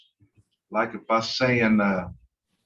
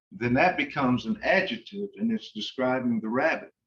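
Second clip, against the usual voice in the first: ordinary delivery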